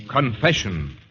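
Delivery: normal talking voice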